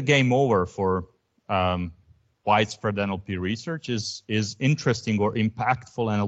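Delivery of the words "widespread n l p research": usual speaking voice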